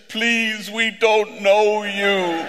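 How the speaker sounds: very slow, high plea with a nervous laugh; a scared mother begging to be left alone; pleading. Retold or imitated.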